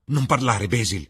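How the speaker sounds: loud, high, sharp outburst; angry command. Acted. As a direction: silence him forcefully. Enraged Dorian cuts Basil off.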